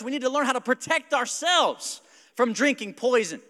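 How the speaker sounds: high, loud, urgent sermon voice; animated pulpit delivery; impassioned preaching. Real, not acted. Preaches at full force.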